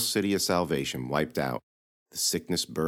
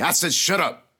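knight barks it, much louder and higher; angry shout; 13 semitones higher, 7 dB louder than usual. Acted. Knight silencing the chattering girl, furious shout. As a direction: cut her off with a furious yell.

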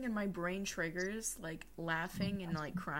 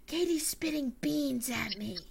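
imitating friend; 7 semitones higher, 5 dB louder than usual. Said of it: "Aslan kept going".

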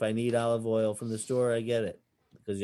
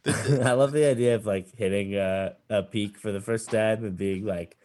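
ordinary talk

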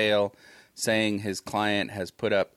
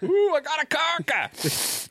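high, drawn-out whoop of a catch; hammed-up excited holler; mock angler's excitement. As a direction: play a thrilled angler, pitched way up. Acts angler hooking a coke fish.

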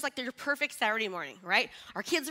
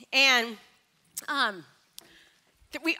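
usual speaking voice

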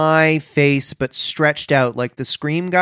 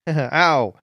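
ordinary talk

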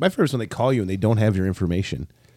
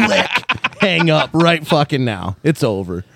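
higher and louder through a laugh, playing the panicked caller; laughing mock panic; 7 semitones higher, 6 dB louder than usual. Spam caller panicking at the fake agent, laughing mock yell. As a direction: squeal out the hang-up, cracking up.

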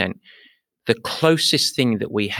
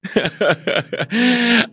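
hearty laugh; 13 semitones higher than usual. Real, not acted. Laughs at pilot story.

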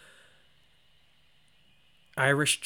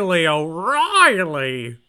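loud, drawn-out mock Irish with hit R's; bad Irish accent, hard R's; 3 semitones higher, 7 dB louder, much slower than usual. Co-host told to hit R's harder, bad Irish accent. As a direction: belt the name slowly in fake Irish, punching the R's. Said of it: "[name], hit those R's harder".

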